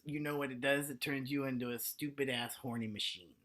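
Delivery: normal talking voice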